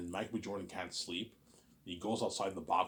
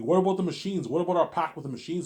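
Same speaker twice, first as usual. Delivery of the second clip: panicked; 9 semitones higher, 10 dB louder than usual. Retold or imitated. Panicked crowd voiced.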